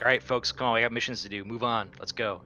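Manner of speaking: rallying the crew. Pretend. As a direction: hurry the group along with a cheery call. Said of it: "whisper to the rest of the people".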